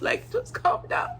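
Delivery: high breathy whisper "just calm down". Shown as a demonstration. Speaker to Serenity, pleading in a high whisper.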